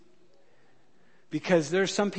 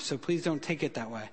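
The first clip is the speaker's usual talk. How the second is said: ordinary talk, nothing marked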